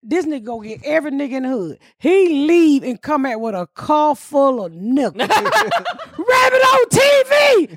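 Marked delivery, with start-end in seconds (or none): 5.3-7.8 very high, long stretched-out cry; excited hollering. Retold or imitated. Neighborhood crowd spotting Rabbit with cameras, excited hollering. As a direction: holler the news, dragging out each word.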